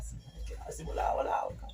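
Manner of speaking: soft unvoiced whisper. Real, not acted. Vlogger whispering to the camera to hold on. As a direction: breathe it under your breath.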